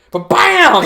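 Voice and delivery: high, loud ba-bam as the pain hits; sudden loud burst. Retold or imitated. Doctor's tap hitting the painful spot, a high loud jolt. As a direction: punch it out like a jolt.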